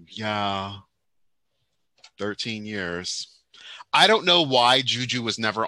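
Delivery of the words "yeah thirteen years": soft, drawn-out mock reply; imitating the guest's reply. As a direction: answer quietly and slowly, quoting her. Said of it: "And she's like".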